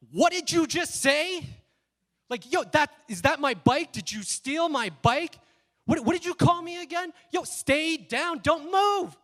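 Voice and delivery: furious high-pitched yelling; going off. Retold or imitated. Pinning the bike thief to the ground, enraged shouting. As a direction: explode at full volume, stretching the key words. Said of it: "I just went off like".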